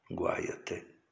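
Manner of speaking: a hushed whisper of warning. Acted. Preacher warning the faithful who criticise the Pope, whispered. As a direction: drop to a whisper to drive it home.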